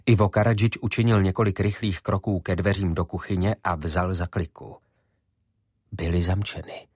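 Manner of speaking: ordinary delivery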